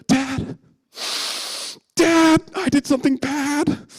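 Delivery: put-on high, wailing child's confession; hammed-up bawling kid, loud and high; mock sobbing. Sobbing son confesses to his dad, hammed-up wail. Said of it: "I'm, like, sobbing uncontrollably".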